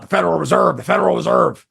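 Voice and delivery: lightly mimicked host harping on a catchphrase; Alex Jones impression. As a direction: repeat it like a gruff ranter, mildly mocking. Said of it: "it was always about".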